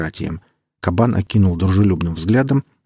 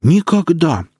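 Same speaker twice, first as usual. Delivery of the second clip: loud, high burst of righteous outrage; indignant outcry; 9 semitones higher, 4 dB louder, slower than usual. Acted. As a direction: protest as if deeply offended. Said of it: "Праведному возмущению Виарну не было границ".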